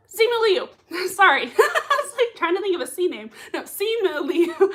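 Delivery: high, loud giggling through the name; laughing at her own slip; laughing at herself. Real, not acted.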